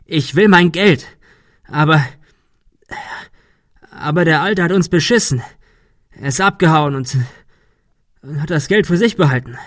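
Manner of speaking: a cheated man struggling to get it out; aggrieved Arthur. Acted. Arthur complains of being cheated out of his money, aggrieved and halting.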